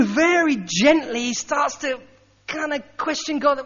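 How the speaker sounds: ordinary talk, nothing marked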